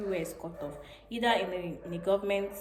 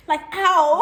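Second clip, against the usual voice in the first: baffled disbelief; 9 semitones higher, 11 dB louder than usual. Real, not acted. Marvels at rice at dawn.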